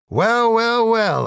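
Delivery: high, drawn-out theatrical shout; angry shout. Acted. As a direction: shout it like an accuser cornering his foe. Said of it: "I shouted angrily".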